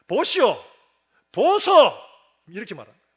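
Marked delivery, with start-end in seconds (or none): 0.1-0.5 high, very loud retort imitating an outraged son; angry shout. Older son confronts his father after coming home, angry shout. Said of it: "열받았다고 아버지한테". 1.3-1.7 very high, loud dialect yell in character; angry shout. Retold or imitated. Son addresses his father with no respect, angry dialect shout. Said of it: "열받았다고 아버지한테".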